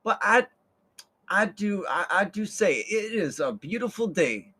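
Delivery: high, delighted genteel lady; charmed old lady thanking him; mock prim lady voice; sweet lady. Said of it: "she's like".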